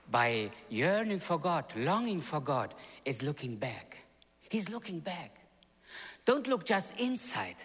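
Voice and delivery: usual speaking voice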